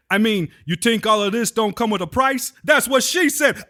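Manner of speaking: bragging Brooklyn father pointing at the house; New York accent. Retold or imitated. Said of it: "talk like they're from New York".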